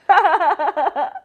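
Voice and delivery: high hearty laugh; roaring with laughter; lion's laugh. Acted. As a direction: laugh like the lion.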